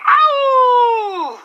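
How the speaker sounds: very high howled ow like a dog. Acted. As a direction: howl it out like a hound baying at the moon. DJ howls in celebration.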